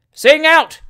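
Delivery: loud, high heckling shout; high, forceful shouted command. A narrator's reading. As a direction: yell it at the singer from the audience.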